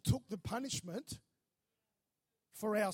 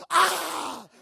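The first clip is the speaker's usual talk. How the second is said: high loud pained yelp; 9 semitones higher, 16 dB louder than usual. Retold or imitated. Boy about to be caned, loud high yelp. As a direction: yelp before the cane even lands. Dwayne yelps before the hit.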